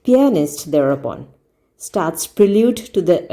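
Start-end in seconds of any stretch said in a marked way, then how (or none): none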